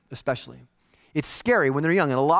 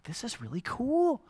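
soft slow content; 2 semitones higher, 9 dB quieter, slower than usual. Retold or imitated. Giver reflecting.

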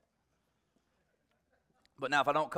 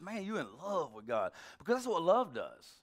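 usual speaking voice

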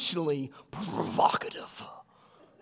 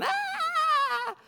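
high mock shriek of a hysterical wife; mock scream. Retelling the wife's argument, a high mock shriek. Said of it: "starts screaming".

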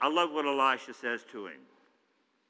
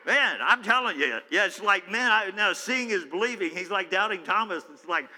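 higher and louder, fired-up repetition; getting excited; 5 semitones higher, 7 dB louder than usual. Real, not acted. Preacher to congregation, getting fired up and loud. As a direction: catch fire and keep saying it. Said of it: "I'm getting excited about this".

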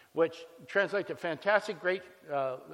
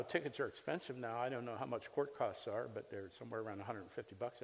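ordinary delivery

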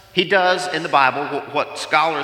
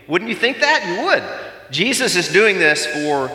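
ordinary talk

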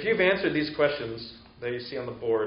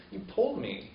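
lightly voiced little boy, pitch lifted; child's complaint; 7 semitones higher than usual. Little boy protesting to his parent, mildly wounded complaint. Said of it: "And he says".

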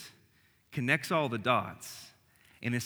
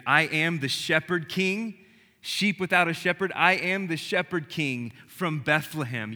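louder, higher preaching at full force; fervent preaching; 3 semitones higher, 5 dB louder than usual. Acted. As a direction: proclaim it like a sermon climax.

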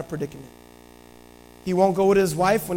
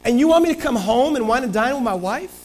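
higher and louder, a soldier's protest; indignant Uriah; 7 semitones higher, 3 dB louder than usual. Acted. Uriah refusing David's offer, raised and indignant. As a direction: push back hard, half-outraged. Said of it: "Uriah's like".

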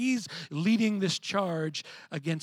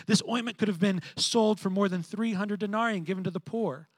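loud, higher indignant protest; indignant disciples; 5 semitones higher, 7 dB louder than usual. Retold or imitated. Disciples protest the waste, loud and indignant. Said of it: "They say".

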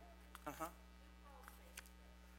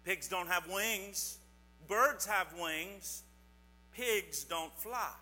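slow explaining; 2 semitones lower, slower than usual. Shown as a demonstration. Teaching kids a point slowly.

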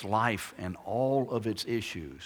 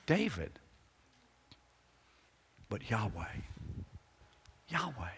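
soft reverent answer; 8 dB quieter than usual. Acted.

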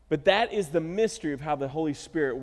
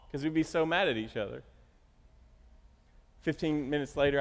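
usual speaking voice

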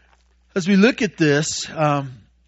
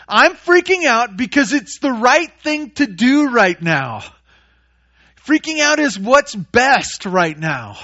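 higher, louder hammed-up disciple insisting panic is right; mock indignant; 7 semitones higher, 7 dB louder than usual. Preacher mocks panicked disciples justifying their panic, higher and louder. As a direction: sarcastically play the panicked disciple defending his freak-out. Mocking disciples' excuses, sarcastic.

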